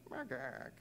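droop the chirp into a mope; sad bat noise. Retold or imitated. The lonely bat's hello gone sad, soft and falling. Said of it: "started to sound more like".

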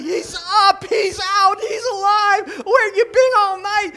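high, loud yelping dog voice; excited dogs. Pretend. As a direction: squeal it like overjoyed dogs. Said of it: "they go crazy".

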